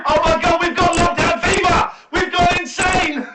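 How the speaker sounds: high, loud, giddy outburst; hysterical shouting. Real, not acted. Host cracks up on air with co-presenters, real hysterical shouting. Hysterical fit after long laughing.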